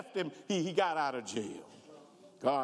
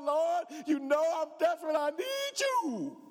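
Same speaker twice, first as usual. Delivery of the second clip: crying out to God; 9 semitones higher than usual. Acted. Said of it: "Was he crying out loud".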